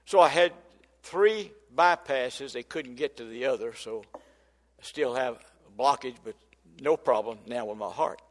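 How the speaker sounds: ordinary delivery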